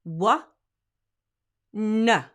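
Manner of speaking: loud "Wha, na" with hard onsets; firm wha na. Shown as a demonstration. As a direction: hit "Wha" and "na" firmly. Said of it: "do this".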